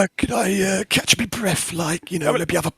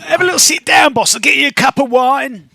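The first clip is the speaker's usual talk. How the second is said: loud, high goblin voice; chirpy goblin, half singing; loud, high goblin fussing over the boss; 9 semitones higher, 4 dB louder than usual. Acted. Goblin urging boss to sit.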